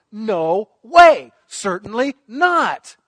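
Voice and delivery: loud, slow, high refusal; emphatic denial. Acted. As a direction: bang down the denial for the congregation. Preacher voicing Paul's firm denial.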